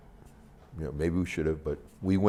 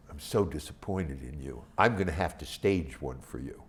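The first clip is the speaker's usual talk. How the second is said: soft, lower, mock stern warden; mock disappointed; 7 semitones lower, 7 dB quieter than usual. Warden scolding the filmmaker, soft and lower. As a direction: scold him gently like a letdown parent.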